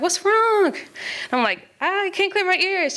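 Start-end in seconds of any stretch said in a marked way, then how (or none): 0.0-0.7 high, louder "what's wrong" called across the water; concerned call. Retold or imitated. Instructor calls out at surface. 1.8-3.0 high, strained and a little urgent; anxious reply. Retold or imitated. Panicked surfacing, can't clear ears.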